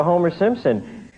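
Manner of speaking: ordinary talk, nothing marked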